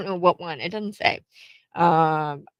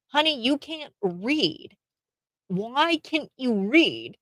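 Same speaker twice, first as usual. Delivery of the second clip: slow, pitched up, lightly mock-concerned; a hint of a scolding teacher; 5 semitones higher, slower than usual. Imagined teacher telling the student she cannot read, mildly voiced. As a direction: talk down to her gently, slowly.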